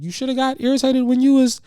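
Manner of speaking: high-pitched mock brotherly needling; high, goading family jab; button-pushing jab. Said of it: "family knows how to press the buttons".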